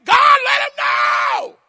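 Elijah's bold shout, high and slow; bold prophet's cry. Acted. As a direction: call fire down from heaven. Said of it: "One time he's saying".

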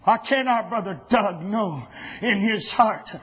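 usual speaking voice

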